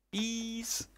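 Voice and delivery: high startled shriek; startled yelp. Real, not acted. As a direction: yelp as the bees sting. Bees attacking him.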